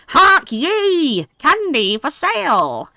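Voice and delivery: high, squeaky goblin crying his wares; goblin vendor call. Acted. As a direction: hawk candy like a shrill little goblin.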